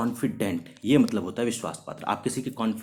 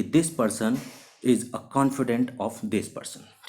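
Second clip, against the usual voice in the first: slow example sentence; much slower than usual. Shown as a demonstration. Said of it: "example sentence read for students".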